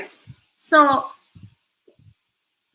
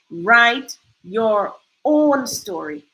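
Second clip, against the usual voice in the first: slow, weighty repeat; very slow emphasis; much slower than usual. Real, not acted. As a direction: drag out "Write your own story" very slowly.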